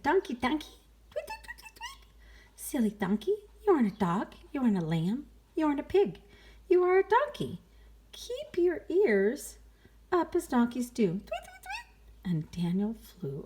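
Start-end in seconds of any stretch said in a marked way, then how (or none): 0.0-0.8 higher chirpy bird voice; sparrow voice. Acted. Sparrow calling to donkey, higher chirpy voice. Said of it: "He said". 1.1-2.1 very high soft chirps; sparrow tweets. Acted. 2.6-11.1 higher, louder chirpy scolding; sparrow voice. Acted. Sparrow telling donkey to keep ears up, high chirpy voice. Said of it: "He said". 11.1-12.3 very high soft chirps; sparrow tweets. Acted. Sparrow chirping before flying.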